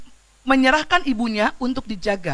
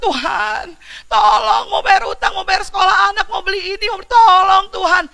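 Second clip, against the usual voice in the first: mock pleading prayer; 11 semitones higher, 10 dB louder than usual. Mocking a begging prayer.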